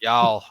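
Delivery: Y'all drawled from the back of the throat; Y'all with a throaty drawl; throaty Southern y'all. Shown as a demonstration. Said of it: "comes from the back of your throat".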